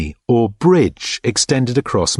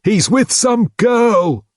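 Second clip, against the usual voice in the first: voice above the rest; 13 semitones higher, slower than usual. A narrator's reading. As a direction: call it out over the crowd. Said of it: "said a voice above the rest".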